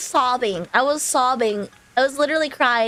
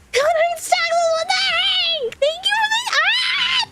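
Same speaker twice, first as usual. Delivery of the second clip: excited squeal. Real, not acted. Streamer reacts to gift subs.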